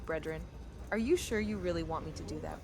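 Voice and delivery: normal talking voice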